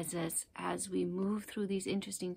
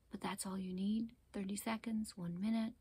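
normal talking voice

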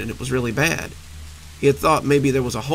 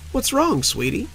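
high, sugary voice on the edge of cracking; forced brightness about to crack; overly chipper, near breaking; 10 semitones higher than usual. Acted. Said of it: "overly chipper voice that verged on breaking".

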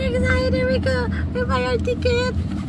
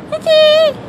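loud high call; 8 semitones higher, 4 dB louder than usual. Real, not acted. Calling the dog loudly.

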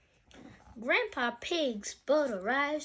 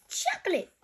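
Susie Sheep; 10 semitones higher, 6 dB louder than usual. Acted. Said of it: "says Susie Sheep".

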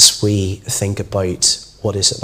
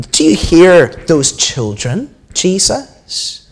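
slow, raised indignant question; complaining; 6 semitones higher, 4 dB louder, slower than usual. A narrator's reading. Religious leaders to Jesus about the children, complaining. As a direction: put the complaint as a pointed, rising question. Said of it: "they complained".

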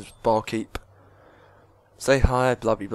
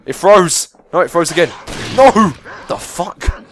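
high, loud frantic cries; desperate rising yells of alarm; panicked yelling; 6 semitones higher, 12 dB louder than usual. Real, not acted. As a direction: shout in real panic at the screen. Game freezes during dog attack.

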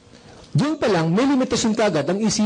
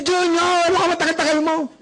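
high, fast call of an impatient mom; a mother calling her son from the door, impatient; 10 semitones higher than usual. Retold or imitated. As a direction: call the kid home like a fed-up mother.

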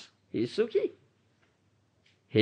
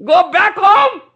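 much higher and louder, ringing command; emphatic quote; 13 semitones higher, 7 dB louder than usual. Retold or imitated. Preacher repeating his guru's emphatic command to listeners, loud and high. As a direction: thunder the guru's words home. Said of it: "emphatically says".

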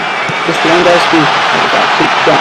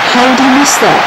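disbelief; 8 semitones higher than usual. Real, not acted. Missed chance while playing.

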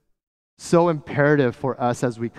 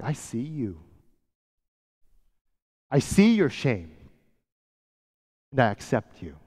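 Jesus gently assuring the outcast woman; gentle reassurance. Acted.

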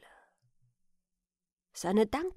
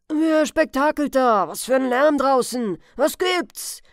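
put-on loud, higher-pitched old man's shout; gruff challenge; 4 semitones higher, 11 dB louder than usual. Old man at the window to whoever knocks, angry shout. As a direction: shout down at whoever is making the racket.

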